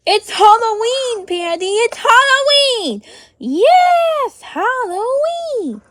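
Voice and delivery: high, thrilled pretend squeal; excited announcement. Telling toy Pandy that Halloween has come, excited. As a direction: burst out with delight, pitch leaping up.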